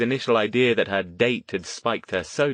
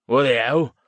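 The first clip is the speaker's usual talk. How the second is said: mild yell; 5 semitones higher, 6 dB louder than usual. A narrator's reading. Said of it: "one of the others yelled".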